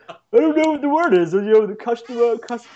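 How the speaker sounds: mocking whiny quote.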